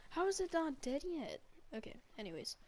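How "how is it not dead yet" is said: high, loud disbelief; can't believe it's still alive; incredulous. Real, not acted. Alligator survives execution.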